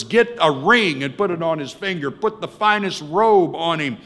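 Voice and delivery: loud, high joyful orders; excited shouting. Acted. Father screaming to servants to prepare a party, excited shouting. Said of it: "He starts screaming to servants".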